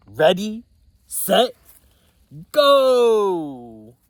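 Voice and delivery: loud, rising ready set go; race start call. Acted. Toad starts the race, loud countdown call. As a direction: count them off and launch the race.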